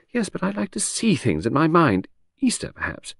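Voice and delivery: breezy chatter that floats from topic to topic; Lucy, lady of the house. Acted. Chatting to her husband in the garden, airy high lady.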